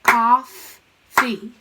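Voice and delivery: Coffee with a held F and a loud first syllable; loud syllable split. Shown as a demonstration. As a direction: lean on the double F in Coffee. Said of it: "V C C V word".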